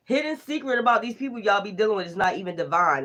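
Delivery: ordinary talk, nothing marked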